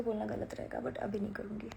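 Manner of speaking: soft unvoiced aside; teasing whispered hint. Real, not acted. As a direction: drop to a secret whisper. Whispered aside about future plans.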